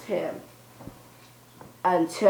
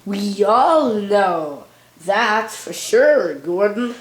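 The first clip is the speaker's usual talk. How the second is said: slow, put-on Scottish accent; child's storytelling take on Scottish. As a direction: draw it out in a mock Scottish accent. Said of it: "said Neil in his Scottish accent".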